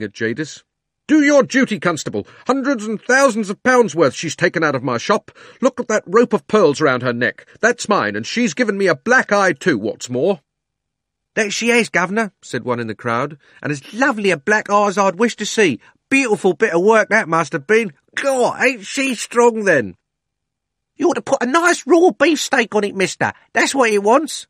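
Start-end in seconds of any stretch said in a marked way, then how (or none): 1.1-10.4 high indignant shout; angry shouting. A narrator's reading. Angry shopkeeper demands arrest. 11.3-12.3 high, loud cheeky Londoner; cockney bystander. A narrator's reading. 13.6-20.0 high, loud cheeky Londoner; cockney bystander. A narrator's reading. 20.9-24.5 high, loud cheeky cockney lad; cheeky errand boy shouting; butcher's boy. A narrator's reading. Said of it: "said a butcher's boy".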